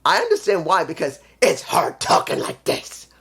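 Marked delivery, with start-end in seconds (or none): 1.4-3.1 strained, half-whispered rasp to show the voice; rough it up like a wrestler's hard growl; throat-straining mock growl; deep hard voice demo. Shown as a demonstration. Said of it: "uses a deep, hard voice".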